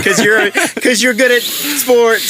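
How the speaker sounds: high, very slow laughing mockery; mock reasoning. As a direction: stretch it out, mocking and laughing. Mocking the crowd's reasoning.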